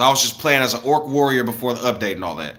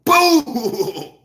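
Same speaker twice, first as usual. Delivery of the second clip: fan crash noise; 11 semitones higher than usual. Retold or imitated. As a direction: imitate the fan's crash with a high cry. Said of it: "fell out the ceiling and went".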